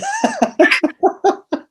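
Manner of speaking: high-pitched burst of laughter; real laugh after own remark. Real, not acted.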